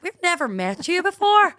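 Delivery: high, loud, slow Northern Irish; put-on Lurgan accent. Suspicious friends to the newcomer, exaggerated Lurgan accent. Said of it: "you're from Lurgan".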